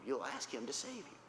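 normal talking voice

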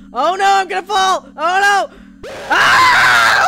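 high, loud frightened shouting; shrieking as she falls; panicked yell. Real, not acted. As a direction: cry out in real panic. Falling into a jump scare.

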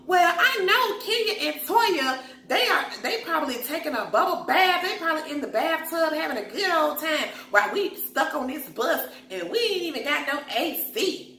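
lightly voiced, jealous complaining; imitating Portia. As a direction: gripe like you're stuck on a hot bus. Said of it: "And Portia's like".

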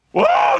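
sudden high whooping cow cry; mock cow bellow. The herd reacts to the wailing cow, high whoop. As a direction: let out a startled herd's cry. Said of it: "they're like".

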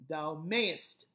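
Thou MAYEST, mayest punched and lengthened; stress on mayest. Shown as a demonstration. Teacher marking the third iamb, stress on mayest. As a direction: pass over Thou, hit mayest. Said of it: "emphasis on mayest".

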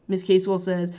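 ordinary talk, nothing marked

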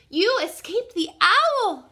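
high, loud, drawn-out exclamation; excited cry. A narrator's reading. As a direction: cry out in amazed joy. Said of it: "cried Stella Luna".

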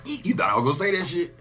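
normal talking voice

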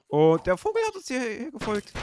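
alarmed. Real, not acted. Bird followed them, alarmed.

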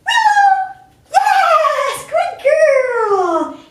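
high, loud sing-song call to the dog; bright praise, sung high and loud. Shown as a demonstration. Trainer calling the puppy by name in a sing-song. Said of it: "We want that sing-song voice".

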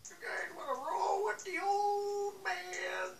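put-on high, slow creepy old man. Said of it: "my old man Jenkins voice".

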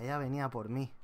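ordinary talk, nothing marked

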